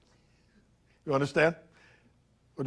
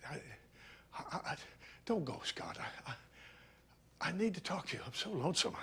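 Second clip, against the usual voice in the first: whispered, much softer, pleading; hushed plea; 2 semitones higher, 17 dB quieter than usual. Acted. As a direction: beg him not to leave, barely voiced. Pleading with departing Scott.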